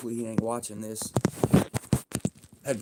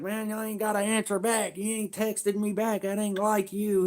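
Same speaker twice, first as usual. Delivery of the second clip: much higher, mock boss voice; imitating the boss; 10 semitones higher than usual. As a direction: voice the boss on the phone. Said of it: "He was like".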